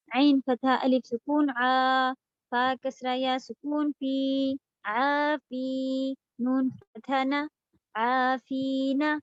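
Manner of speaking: slow, drawn-out syllable spelling. Shown as a demonstration. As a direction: spell each syllable slowly. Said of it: "کیسے؟".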